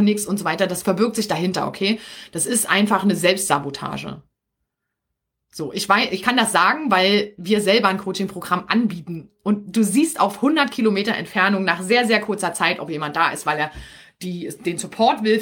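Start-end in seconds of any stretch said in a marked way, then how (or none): none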